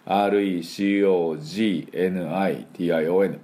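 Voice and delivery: ordinary talk, nothing marked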